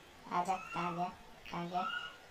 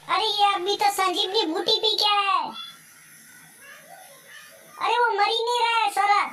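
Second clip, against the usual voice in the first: furious rant; 7 semitones higher, 4 dB louder than usual. Real, not acted. Enemy survives headshots, furious rant.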